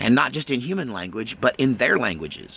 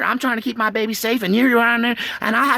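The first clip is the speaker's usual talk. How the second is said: higher, protective scolding; mama squirrel; 9 semitones higher than usual. Retold or imitated. Mama squirrel warning an intruder near her nest. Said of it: "that's a mama squirrel saying".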